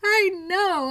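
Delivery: mock exasperation. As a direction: play up the despair. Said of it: "I'm like".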